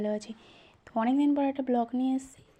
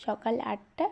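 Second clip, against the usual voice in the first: ordinary talk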